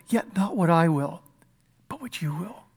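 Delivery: soft yielding words fading almost to nothing; surrendered whisper. Acted. Preacher voicing Jesus yielding to his Father, fading to a hush. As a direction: let the voice drop away as you give in.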